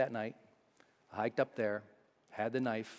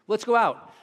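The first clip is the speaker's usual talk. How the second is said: friend urging; 10 semitones higher, 9 dB louder than usual. Retold or imitated. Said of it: "friends coaxing him out".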